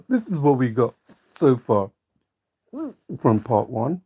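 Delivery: talking through the hiccups; hiccuping. Real, not acted. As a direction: hiccups breaking into the words. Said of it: "I'm, uh, hiccuping".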